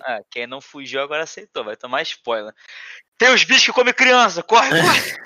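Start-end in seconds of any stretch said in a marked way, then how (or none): 3.2-5.2 mock panic. Joking warning about child-eating monsters.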